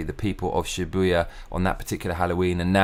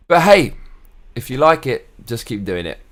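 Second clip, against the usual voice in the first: loud shrug; 4 semitones higher, 7 dB louder than usual. Real, not acted. Sudden cheerful shrug to viewers.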